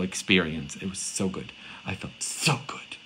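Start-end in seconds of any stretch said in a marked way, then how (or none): 1.9-3.0 slow, savouring whisper; drawn-out, hushed delight; blissful whisper. Real, not acted. Savouring the relief of confession.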